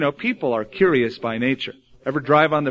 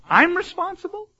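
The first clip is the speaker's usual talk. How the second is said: much higher, astonished "I'm responsible?"; mock incredulous. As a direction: squeak it out in disbelief. Abraham's incredulous reply.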